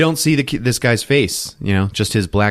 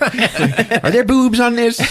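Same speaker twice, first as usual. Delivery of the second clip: high, drawn-out mock mother voice; outraged mother; 13 semitones higher, slower than usual. Mother checking her son's puzzle pieces, mock outrage. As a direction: stretch the question out high in mock horror.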